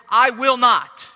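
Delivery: loud, slow, emphatic refusal; defiant. Acted. Defiant son refusing his father's request to work, defiant. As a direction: dig in and refuse forcefully. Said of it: "This boy is defiant".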